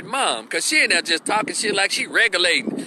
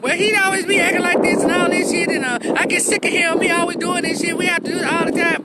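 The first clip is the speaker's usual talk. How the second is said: put-on complaining girl; 10 semitones higher than usual. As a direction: whine and vent about him. Girl complaining to police, high voice.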